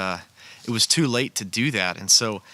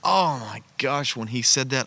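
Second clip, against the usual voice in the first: ordinary talk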